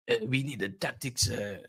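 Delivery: mock Dutch-accented manager, quietly hesitant; Ten Hag. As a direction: imitate a Dutch manager with a South African twang. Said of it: "you get Ten Hag's voice".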